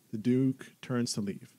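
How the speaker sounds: ordinary talk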